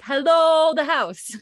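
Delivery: big, high, drawn-out holler; hailing a house. Shown as a demonstration. Demonstrating the spelling to co-host, hailing loudly. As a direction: call it out loud and long. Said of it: "It's like".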